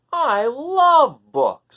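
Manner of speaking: slow, loud, high delighted mouse; drawn-out happy mouse voice; mouse loving books. Acted. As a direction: stretch it out like an eager little mouse. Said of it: "said the mouse".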